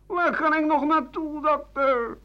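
a distressed patient begging the doctor for help; imitated woman patient. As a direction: plead with the doctor as a fretful woman.

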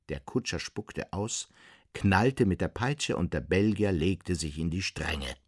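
ordinary delivery

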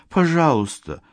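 put-on high, begging young woman; pleading girl. Yulia pleading.

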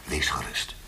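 sinister whispered comfort; menacing whisper. Acted. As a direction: hush it like a threat. Interrogator's menacing reassurance.

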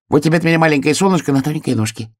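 high, quick little voice; excited hedgehog. A narrator's reading. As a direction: chatter it out high and fast. Hedgehog presents a flower excitedly. (быстрый тонкий голосок ёжика)

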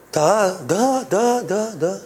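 ordinary delivery

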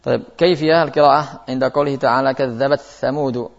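ordinary talk

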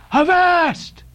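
high hailing cry of "Avast". A narrator's reading. Stranger hailing two men from behind, crying out. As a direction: call out sharply to stop them. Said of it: "cried a voice".

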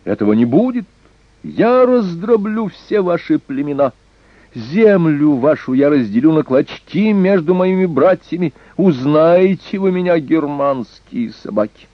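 high, raging threats; furious cry. A narrator's reading. Young lord threatening the Germanic tribes, furious cry. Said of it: "закричал Эрнак".

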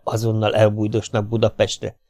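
ordinary delivery

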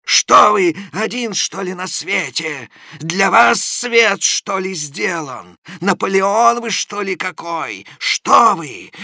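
high, loud, slow angry interrogation; shaking with anger, demanding an answer; furious scolding. A narrator's reading. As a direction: hammer him with questions in rage. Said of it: "весь дрожа от досады и бешенства".

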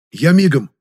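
high, loud eager reply; delighted readiness. A narrator's reading. Relieved Жасминов rushes off for vodka, delighted. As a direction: jump at the errand happily. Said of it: "просиял Жасминов".

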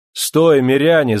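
high, loud command to stop; shout. Acted. Perstень stops the crowd with a loud high shout. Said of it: "закричал он".

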